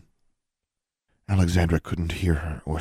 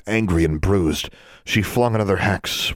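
plain reading voice

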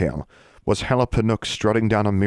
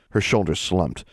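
usual speaking voice

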